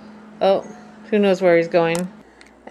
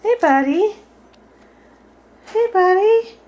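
high, sing-song coaxing of a parrot; high pet talk; 11 semitones higher than usual. Real, not acted.

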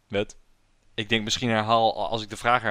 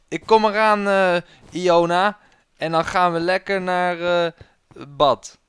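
loud, raised rescuer's call; a hint of mock gallantry; lightly acted hero; 6 semitones higher, 6 dB louder than usual. Hero reassuring princess Iona, raised loud voice.

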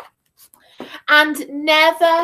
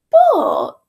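high, loud, stretched-out but; teasing twist; 6 semitones higher, 6 dB louder than usual. A narrator's reading. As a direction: pop it up high, then let it drop. Teasing twist after the promise.

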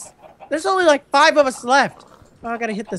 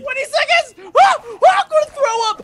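panicked excitement; 9 semitones higher than usual. Real, not acted. Hider with seconds left, panicked high shout. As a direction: shout as if nerves are about to burst. Hider nearly surviving the countdown.

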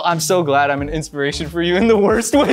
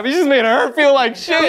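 laughing; 12 semitones higher, 6 dB louder than usual. Real, not acted. Group cracking up at the ranking.

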